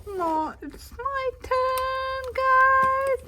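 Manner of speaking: high, loud and drawn out; insistent shout. Real, not acted. Kid shouting at the others that it's her turn, high and loud.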